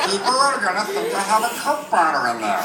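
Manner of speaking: high helium squeak, sliding down at the end; embarrassed patient on helium. Acted. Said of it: "with the helium voice".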